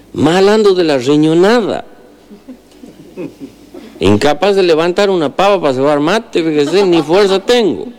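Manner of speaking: put-on high-pitched sick old man asking for help; ailing countryman. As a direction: voice the patient well above normal pitch, asking a favour.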